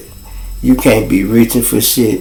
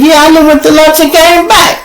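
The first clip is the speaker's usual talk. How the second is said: high-pitched, louder exclamation; comic complaint, voice way up; retold exasperated quip; 14 semitones higher, 5 dB louder than usual. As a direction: hoot it out in mock exasperation. Retold exasperated joke about long chase.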